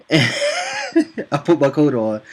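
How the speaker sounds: loud, high, slow laughing repeat. Real, not acted. Storyteller recalling walking off the chat, laughing. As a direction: crack up while saying it, drawn out.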